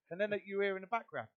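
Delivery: normal talking voice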